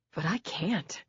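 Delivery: breathless whisper; whispered refusal. Acted. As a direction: barely voice the refusal. Refusing husband's plea.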